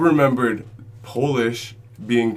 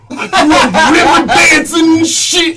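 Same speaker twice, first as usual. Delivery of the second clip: high, loud outraged shout; mad uncle; 6 dB louder than usual. Retold or imitated. Teller imitates his uncle at the family reunion, mock-furious shout. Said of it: "he was mad. He was like".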